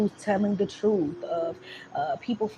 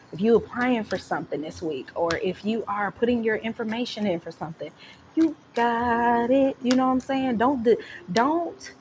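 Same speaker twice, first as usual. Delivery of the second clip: ordinary delivery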